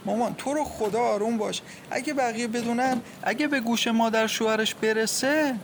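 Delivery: high, urgent plea; anxious pleading. A narrator's reading. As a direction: beg a panicking mother to calm down. Daughter begs panicking mother to calm.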